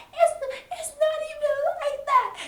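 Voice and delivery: very high squeaky protest; pleading denial. Retold or imitated. Maria denying the accusation, very high whiny protest.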